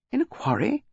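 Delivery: QUARRY pitched high as an incredulous question; stunned, high-pitched rising question; astonished. A narrator's reading. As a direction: echo "In a quarry?" in high disbelief. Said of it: "His astonishment was evident".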